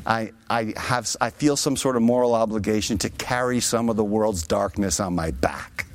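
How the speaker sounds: a low, soft hint of [name]. Preacher quoting [name] to the congregation, low and hushed. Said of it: "And he would say".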